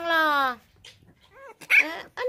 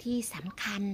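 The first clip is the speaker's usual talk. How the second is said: whisper; 3 semitones lower, 8 dB quieter than usual. Real, not acted. Confiding aside to viewers.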